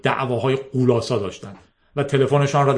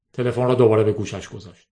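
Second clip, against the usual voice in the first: plain reading voice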